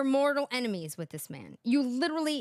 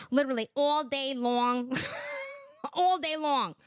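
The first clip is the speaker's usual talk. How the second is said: Fran Drescher voice; 4 semitones higher than usual. Retold or imitated. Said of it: "Fran Drescher".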